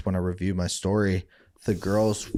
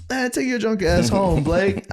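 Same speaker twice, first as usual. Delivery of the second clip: much higher dismissive buddy; mock friend's voice; 10 semitones higher, 3 dB louder than usual. Friends sending the drunk home, high dismissive voice. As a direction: brush him off like a squeaky pal.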